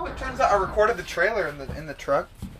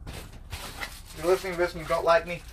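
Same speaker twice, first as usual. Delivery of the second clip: ordinary delivery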